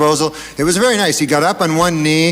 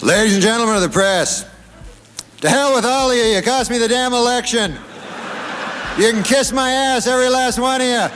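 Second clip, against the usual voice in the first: high, gruff angry senator; Bob Dole voice; 9 semitones higher than usual. Acted. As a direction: read it as a bitter old senator. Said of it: "This is from, from Senator Bob Dole".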